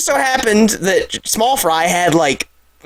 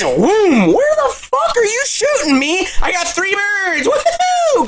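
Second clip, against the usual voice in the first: high, whooping cheer; wild excitement; 13 semitones higher, slower than usual. Retold or imitated. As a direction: crow about your haul. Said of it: "and it's".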